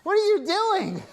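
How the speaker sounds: high alarmed question. Acted. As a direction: demand it in shock. Startled by costumed friends.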